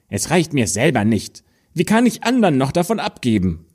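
put-on a spoiled girl rushing to refuse; greedy daughter brushing off the dwarves; selfish refusal.

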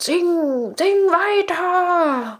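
high, drawn-out cry of sing; slow hammed-up call, very high; Ursula calling. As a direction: urge her on like the witch. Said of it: "Und Ursula ruft".

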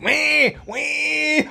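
put-on high squealing baby voice. Father imitates the baby squealing.

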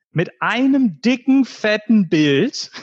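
dicken and fetten drawn out slow and high; drawn-out emphasis. Retold or imitated. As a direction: stretch out dicken fetten Bild. Stretching out the big picture.

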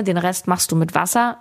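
ordinary talk

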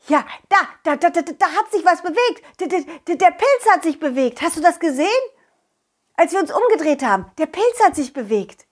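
high, stammering excitement; breaking the news in a flustered rush; excited alarm. Acted. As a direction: stumble over the words in startled discovery. Sees the mushroom move.

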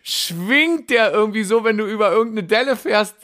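hoch und lebhaft vor Begeisterung; erzähl es voller Vorfreude; begeistert. Real, not acted. Schwärmt begeistert vom Schwingsitz. (ganz aufgeregt und hoch)

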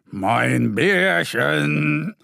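slow, stretched call from a grandpa bear; drawn-out greeting to his grandson. A narrator's reading. Grandpa bear greets little Mo, slow stretched call. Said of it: "ruft der Bärenopa".

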